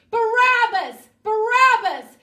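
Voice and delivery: high, full-force shout; crowd yelling. Acted. The people answer Pilate's question, yelling. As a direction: yell it like a baying mob. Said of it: "the people started yelling".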